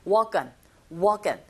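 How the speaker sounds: "walk on" joined as "waw-kun"; linked sounds. Shown as a demonstration. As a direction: carry the k of "walk" into "on". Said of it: "연음 현상". ("walk on"을 '워컨'처럼 연음으로)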